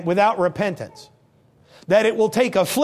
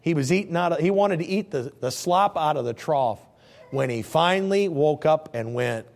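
ordinary talk

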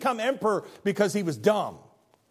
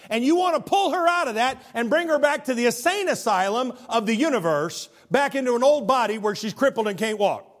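louder and higher, building hard; rising preaching climax; 6 semitones higher, 5 dB louder than usual. Real, not acted. Preacher challenges the grieving teen, loud and building. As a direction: drive the point home with force.

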